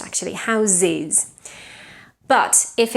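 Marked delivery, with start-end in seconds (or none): 0.3-1.3 "Houses" with a voiced Z before the ending; voiced plural. Shown as a demonstration. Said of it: "it becomes voiced".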